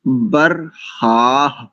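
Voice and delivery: बर्हाह slowly, with a clear breathy h at the end; slow pronunciation demo. Shown as a demonstration. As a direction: draw out बर्हाह and let the final h breathe. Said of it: "ऐसा हो जाएगा".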